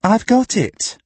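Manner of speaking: high-pitched eureka from a story character; excited announcement. A narrator's reading. Trueblood announces his bright idea.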